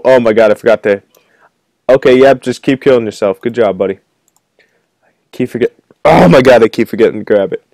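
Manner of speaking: ordinary talk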